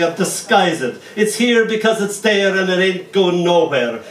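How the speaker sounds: ordinary talk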